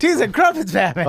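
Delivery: high, loud hammed-up sidekick cry; a cartoon sidekick's exclamation; mock Robin exclamation. Joking Robin-style line to the host, high mock exclamation. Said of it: "Robin-style catchphrase".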